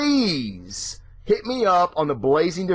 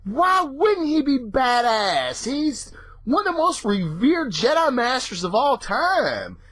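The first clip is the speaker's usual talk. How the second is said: obvious-of-course tone; 8 semitones higher than usual. Retold or imitated. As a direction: act like the answer is obvious. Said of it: "and you're like".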